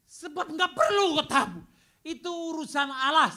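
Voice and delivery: emphatic preaching. Real, not acted. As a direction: press the point home. Stressing it is God's business.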